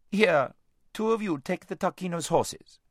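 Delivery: high, commanding call; calling out orders. A narrator's reading. Lazareline to the slaves about the horses, calling out. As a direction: call out to the servants.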